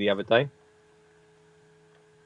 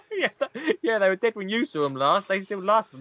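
laughing; 6 semitones higher than usual. Real, not acted. Laughing echo of friend's joke.

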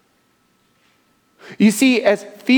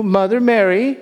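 ordinary talk

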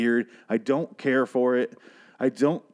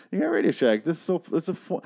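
put-on high sing-song talk as if to a toddler; mock condescending cooing; 8 semitones higher than usual. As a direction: coo at it like a cute relic. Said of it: "I was like".

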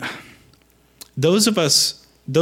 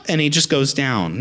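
normal talking voice

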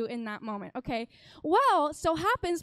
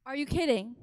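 ordinary delivery

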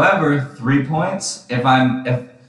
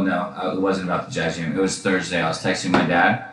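ordinary delivery

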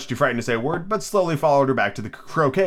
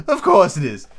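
Duchess voice; 6 semitones higher, 5 dB louder than usual. Acted. Duchess voiced in read story.

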